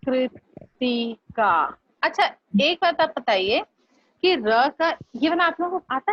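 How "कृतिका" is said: कृतिका drawn out slowly, the कृ sound clear; slow pronunciation demo. Shown as a demonstration. Teacher models कृतिका slowly for the class. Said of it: "आता है ये वाला कृतिका?".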